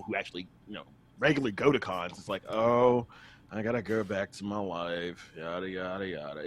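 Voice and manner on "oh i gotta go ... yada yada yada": low, soft, slow gloom sinking away; mock dejection. Voicing con-goers going back to normal life, mock dejected. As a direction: sigh it out like a half-hearted mope.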